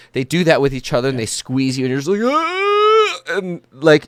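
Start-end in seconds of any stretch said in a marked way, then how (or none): 2.2-3.2 a sharp high gasp as the breath is squeezed out; winded gasp. Acted. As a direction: yelp like you've been crushed in a hug. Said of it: "it's like you got the breath knocked out of you".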